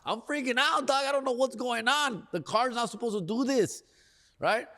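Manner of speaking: put-on high-pitched panicky little girl; little-girl freak-out. Driver telling how his car failed on the freeway, freaking out like a little girl. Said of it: "the little girl in me starts to freak out".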